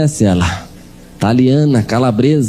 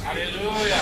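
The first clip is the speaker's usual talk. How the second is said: soft hallelujah; 7 semitones higher, 13 dB quieter, slower than usual. Acted. Murmured aside amid story.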